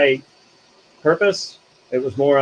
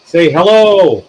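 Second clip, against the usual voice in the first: loud prompt; 5 semitones higher, 10 dB louder, slower than usual. Real, not acted. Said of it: "you sound like this".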